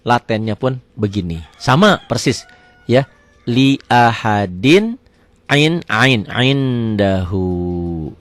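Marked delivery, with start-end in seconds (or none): 3.4-8.1 drawn-out, chanted Arabic reading; measured tajwid recitation; slow Quran recitation. Shown as a demonstration. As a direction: recite it slowly, stretching each vowel. Teacher recites Quranic phrase.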